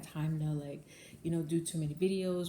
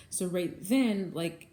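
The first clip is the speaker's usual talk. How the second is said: usual speaking voice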